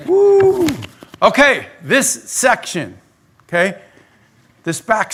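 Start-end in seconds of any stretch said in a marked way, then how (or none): 0.1-0.7 high, bright woo after an awkward silence; excited whoop. Real, not acted. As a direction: whoop the crowd back to life. Pastor reacts to silent room.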